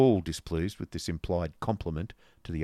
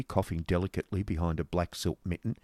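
ordinary delivery

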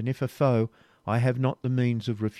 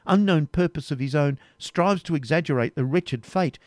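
higher, impassioned reproach; indignant accusation; 7 semitones higher, 5 dB louder than usual. Acted. Rebecca accusing the Templar who dragged her here, impassioned. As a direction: hurl the blame back at him.

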